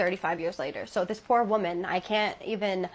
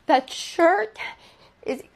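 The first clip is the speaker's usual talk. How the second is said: dread; 4 semitones higher, much slower than usual. Retold or imitated. Husband terrified of possessed shirt.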